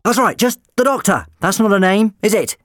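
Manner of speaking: high, loud eager insistence; high insistent doctor. Acted.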